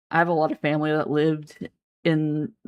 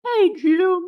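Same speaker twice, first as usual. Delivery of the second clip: squeaky, very high, louder cartoon voice; Carl Wheezer; 5 dB louder than usual. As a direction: call out in a squeaky cartoon pitch. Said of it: "he brings his voice up".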